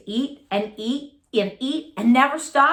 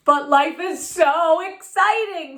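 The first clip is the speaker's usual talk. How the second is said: excited; 6 semitones higher, 4 dB louder than usual. Acted. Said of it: "said Clark".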